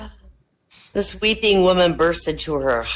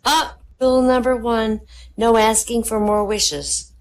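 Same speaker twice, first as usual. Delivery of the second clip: genie; 7 semitones higher than usual. Acted. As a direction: lay down the rule like a genie. Genie speaks.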